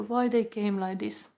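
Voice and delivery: usual speaking voice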